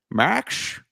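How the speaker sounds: slushy movie-star impression on one word; botched Sean Connery. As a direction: test out a bad celebrity voice. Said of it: "I sound like Sean Connery".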